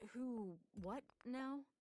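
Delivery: slow, puzzled innocent question; feigned confusion. Acted. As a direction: play dumb, drawn out. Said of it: "Ziri feigned confusion".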